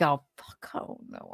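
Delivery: put-on a child's whispered panic; whispered dread. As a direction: mutter it in private horror. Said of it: "you're like".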